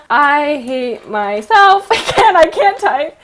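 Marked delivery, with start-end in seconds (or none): 0.1-1.9 very drawn out and louder; slow dictation. Real, not acted. Vlogger typing her conclusion aloud, loud slow dictation. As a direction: spell it out word by word as you type.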